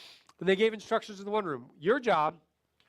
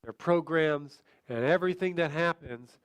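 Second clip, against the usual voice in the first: slow, lower, drawn-out words; really slow talk; 4 semitones lower, slower than usual. Shown as a demonstration. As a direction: drag every phrase out slowly. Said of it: "talking really slow".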